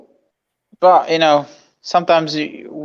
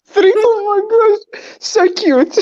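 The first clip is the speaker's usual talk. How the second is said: cooing; 13 semitones higher than usual. Real, not acted. S1 cooing at the thought of a baby.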